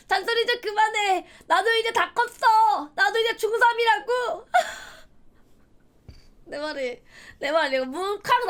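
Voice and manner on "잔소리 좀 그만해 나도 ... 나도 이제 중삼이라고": high, loud, drawn-out protest; mock teen tantrum. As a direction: yell back at mom like a hammed-up middle schooler. 엄마에게 대드는 중학생 흉내. (중학생처럼 높고 크게 떼쓰며 대드는 흉내)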